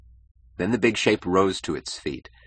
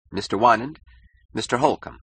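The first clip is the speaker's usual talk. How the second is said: a touch of reluctance, higher; reluctant; 6 semitones higher, 3 dB louder than usual. A narrator's reading. Keating introducing Holcomb to Wynand, reluctantly. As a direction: introduce them as if you'd rather not. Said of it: "with obvious reluctance said".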